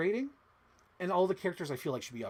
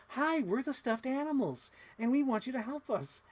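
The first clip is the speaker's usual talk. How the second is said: little stuffed-animal voice; 10 semitones higher than usual. Retold or imitated. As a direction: play a cute little plush toy pitched high. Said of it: "stuffed animals sound like little generic".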